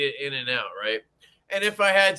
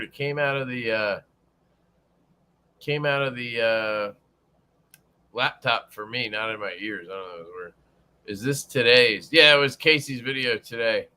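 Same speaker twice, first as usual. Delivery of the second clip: normal talking voice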